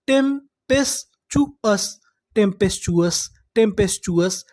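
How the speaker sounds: टेम्पेस्चुअस drawn out syllable by syllable; slow syllable-by-syllable. Shown as a demonstration. Teacher slowly sounding out the full word for students. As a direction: stretch टेम्पेस्चुअस out slowly, part by part. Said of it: "तो पूरी meaning क्या बनी?".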